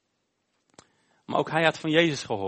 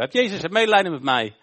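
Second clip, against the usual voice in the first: loud, high-pitched plea; nog harder schreeuwen; 6 semitones higher, 9 dB louder than usual. Acted. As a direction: cry out over those silencing you. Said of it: "hij begon nog harder te schreeuwen". (luide, hoge smeekroep)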